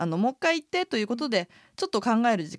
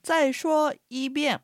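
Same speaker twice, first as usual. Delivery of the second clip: slow, careful model reading; model sentence; 5 semitones higher, much slower than usual. Shown as a demonstration. Presenting today's phrase to radio learners, slow and careful. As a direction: say it slowly for learners. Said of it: "今日のセンテンスです".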